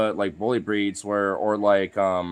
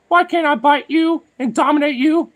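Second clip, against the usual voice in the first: much higher, louder whiny dog voice; voicing the bulldog; 8 dB louder than usual. Owner voices the bus bulldog whining at his dog, high and loud. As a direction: squeal it out like a bossy little dog whining. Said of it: "the other dog wanted to whine 'cause, like".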